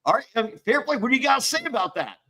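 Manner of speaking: high, fast, taunting judge voice; hammed-up TV judge baiting a guest; mock judge baiting. Imitating judge baiting him.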